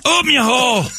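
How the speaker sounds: very high, crude Scottish caricature; mock Scottish accent. Co-host showing how Scots really talk, mock Scottish. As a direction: squawk it in exaggerated Scottish. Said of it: "That's not how they talk".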